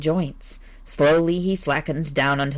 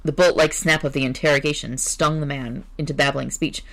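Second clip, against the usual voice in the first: plain reading voice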